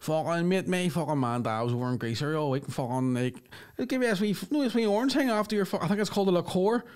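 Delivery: lightly voiced Belfast lad; spidey Belfast lad. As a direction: imitate a Belfast passenger telling his mate. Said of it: "I hear one of them going".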